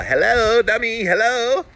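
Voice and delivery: very high, loud cartoonish hello; mock wake-up call. Circling back to someone who missed it, mock hollering. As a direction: holler it high, as if getting through to a dummy.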